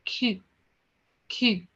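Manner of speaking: "Q" as French "kü", lips rounded. Shown as a demonstration. Teacher has class copy French Q, lips rounded. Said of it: "Just round your lips".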